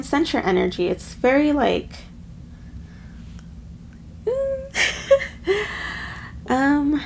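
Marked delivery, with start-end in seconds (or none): 4.2-6.4 high emotional laugh. Real, not acted. Emotional laugh mid-reading.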